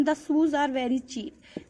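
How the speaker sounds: reads the example sentence out slowly and softly; slow example reading. Acted. Teacher reading word-choice examples to students, slow.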